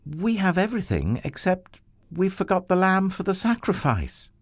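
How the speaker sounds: put-on high, innocent boy's voice; a curious child pointing out what's missing; young boy. Isaac asks his father about the missing lamb, high and innocent. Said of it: "Isaac said".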